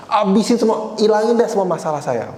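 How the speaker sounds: imitating an upset plea to God, high-pitched; retold angry prayer. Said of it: "saya marah banget sama Tuhan". (menirukan doa marah kepada Tuhan, nada tinggi)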